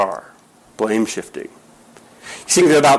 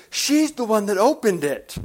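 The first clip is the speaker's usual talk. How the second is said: put-on boy blaming sister; 6 semitones higher than usual. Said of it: "he points to the girl and says".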